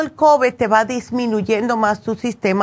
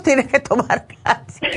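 laughing; 3 semitones higher than usual. Real, not acted. Jokes about having bones.

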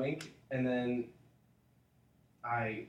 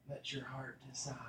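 whisper; 10 dB quieter than usual. Acted. Mock solemn advice to player.

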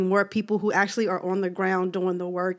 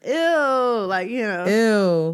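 Caribbean accent; much slower than usual. Shown as a demonstration. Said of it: "You just gotta, like".

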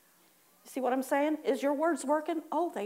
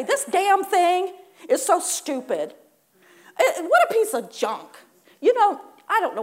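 mock irritation; 6 semitones higher, 6 dB louder than usual. Worker cursing broken equipment in hammed-up annoyance. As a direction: gripe at the broken machine. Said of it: "and you say".

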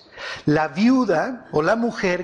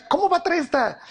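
much higher, scandalized put-on protest; mock indignation; 8 semitones higher than usual. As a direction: protest in a high, incredulous character voice. Fulano rechaza a la moabita. (protesta indignada y fingida, muy aguda)

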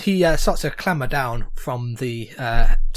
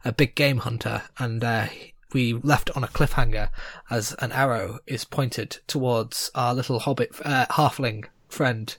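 story narration; 4 semitones lower, 6 dB quieter than usual. A narrator's reading. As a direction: set the scene calmly for players. GM recaps the scene.

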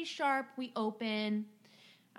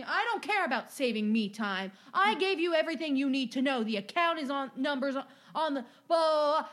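louder, higher dismissive rant ending in blah blah; mock angry customer; 5 semitones higher, 6 dB louder than usual. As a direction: brush her off loudly like an entitled customer.

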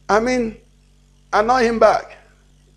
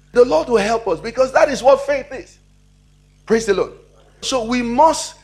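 normal talking voice

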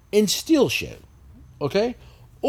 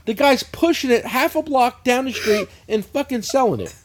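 high, louder laughing rant; mock rant; 6 semitones higher, 4 dB louder than usual. Mocking the free water giveaway, high laughing rant. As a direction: scold them all, half laughing. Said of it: "I'm like".

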